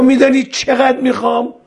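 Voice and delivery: high-pitched testing question; quizzing. Retold or imitated. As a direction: pose the riddle high and pointed. Bohlul quizzes Harun in acted dialogue.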